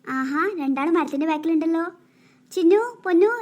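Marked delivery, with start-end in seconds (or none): 2.5-3.3 loud call across the playground; calling the hiding kids out. Acted. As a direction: shout their names to find them.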